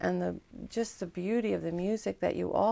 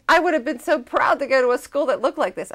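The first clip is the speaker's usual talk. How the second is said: put-on fast, high, louder gushing teen; excited; 6 semitones higher, 7 dB louder, faster than usual. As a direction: gush about it with excitement. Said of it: "She goes".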